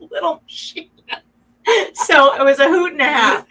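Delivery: half laugh, half cry; a teary chuckle; laughing through tears. Real, not acted. Remembering sewing the quilt with her son, laughing through tears.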